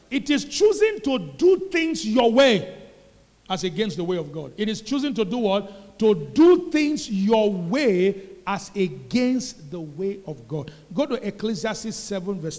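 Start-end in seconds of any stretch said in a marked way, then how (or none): 0.1-10.7 preaching at full force. Real, not acted. The pastor drives the point into the congregation, preaching loud. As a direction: hammer each word of the definition home.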